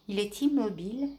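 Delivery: ordinary delivery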